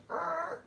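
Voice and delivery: very high imitated brake squeal; imitated car screech. Man acting out a car braking to a stop, high screech. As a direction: squeal like tyres stopping short. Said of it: "he goes".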